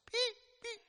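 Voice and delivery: tiny high bird cheeps; Pete voice. Acted. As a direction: peep like a baby bird. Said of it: "He's getting my Pete voice".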